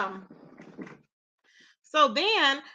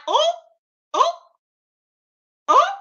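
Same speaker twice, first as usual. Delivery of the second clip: shocked oh; 6 dB louder than usual. Retold or imitated. Shocked reaction.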